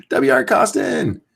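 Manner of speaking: slow, high, amused rereading through a laugh. Real, not acted. Streamer laughing at a viewer's username, amused. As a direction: savor the name while chuckling.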